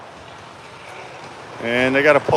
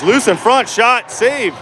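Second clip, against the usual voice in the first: excited shout; 5 semitones higher, slower than usual. Real, not acted. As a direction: yell the save, then stretch it out. Scramble and save at net.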